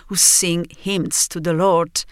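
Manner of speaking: ordinary delivery, nothing marked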